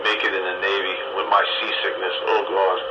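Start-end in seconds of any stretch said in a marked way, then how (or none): none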